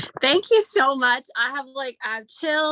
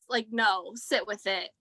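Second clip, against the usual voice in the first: ordinary talk, nothing marked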